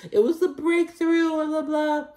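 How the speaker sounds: very high, whiny mock quote; mocking imitation. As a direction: parody her in a silly, squeaky high pitch. Mocking Adriana's claim about her song.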